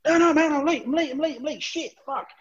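high, rushed, repetitive panic; acted fluster. Late crewman running in to grab money, acted fluster. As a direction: gabble it in a mock flap.

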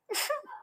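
tiny high, soft mock laugh; scoffing snicker. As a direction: finish with a condescending little snicker. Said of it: "evangelicals are scoffing at us".

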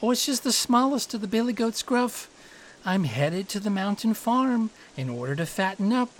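high, delicate goat voice; delicate little goat. A narrator's reading. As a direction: answer the troll as the littlest goat. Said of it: "said the goat with a delicate voice".

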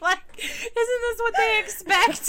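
high giggly talk through laughs; words breaking into giggles; laughing. Real, not acted. Giggling about the joke.

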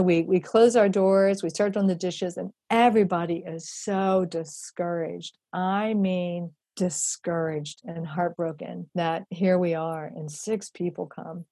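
ordinary talk

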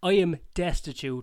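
loud, slow plea of poverty; dramatic outburst. Acted. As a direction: overplay the misery loudly. Dramatic rant at airline agent.

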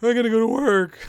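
high, loud whine of "I gotta go to work"; mock complaining worker voice; whiny quote. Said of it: "Or you think".

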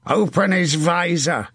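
high-pitched battle order; shout. A narrator's reading. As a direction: shout the order to your squire. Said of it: "he shouted".

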